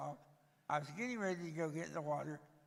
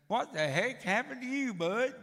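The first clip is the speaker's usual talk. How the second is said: a louder, higher rough stranger's call; voicing the blunt beach guy, louder; mock stranger; 6 semitones higher, 8 dB louder than usual. Stranger's rude beach question retold.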